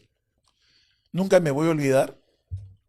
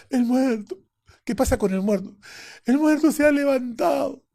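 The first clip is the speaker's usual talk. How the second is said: high, loud panicked voice; frightened nurse; 10 semitones higher, 5 dB louder than usual. Retold or imitated. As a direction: gasp it out in terror. Nurse panicking, telling doctor.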